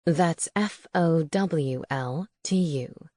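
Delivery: taunting owl voice. A narrator's reading. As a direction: spell it out mockingly, low. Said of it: "Sedgwick taunted".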